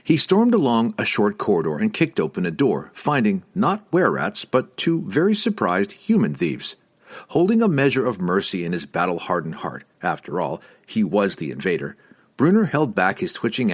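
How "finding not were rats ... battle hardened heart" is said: plain reading voice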